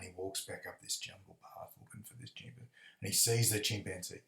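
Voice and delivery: normal talking voice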